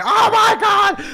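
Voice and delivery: very high, slow re-enacted scream of disbelief; astonished yell. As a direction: yell it out as if the neighbours can hear. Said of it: "I'm just swearing, going".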